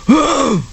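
high, gasping put-on grunt; quick effortful huff; tennis grunt. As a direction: gasp out a short tennis grunt. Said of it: "Oh, that was manly".

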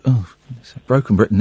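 ordinary talk, nothing marked

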